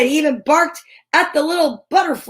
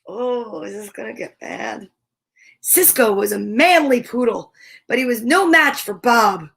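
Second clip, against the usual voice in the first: usual speaking voice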